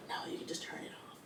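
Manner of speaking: unvoiced, quick whisper of mom. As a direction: whisper it off-handedly. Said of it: "She's like".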